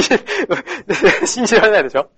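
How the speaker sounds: laughing while speaking. Real, not acted. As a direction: say it through laughter. Laughs at own joke. (笑いながら)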